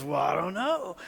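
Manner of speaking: high, loud mock helplessness; exasperated wife. Wife answering husband's worry about dying, comically flustered.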